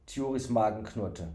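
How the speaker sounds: plain reading voice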